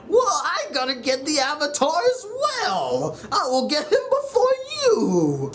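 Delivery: high-pitched weaselly whine, mocking. General Zhao vying with Zuko, mock weasel. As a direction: put on a silly high weasel voice. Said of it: "like a damn weasel".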